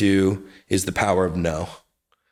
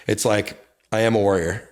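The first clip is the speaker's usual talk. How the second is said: usual speaking voice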